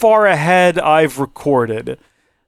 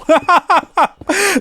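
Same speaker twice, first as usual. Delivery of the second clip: high laugh. Real, not acted. Laughs at own joke.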